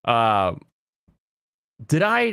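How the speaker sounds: ordinary delivery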